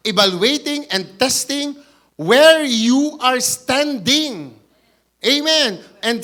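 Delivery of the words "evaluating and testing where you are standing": ordinary delivery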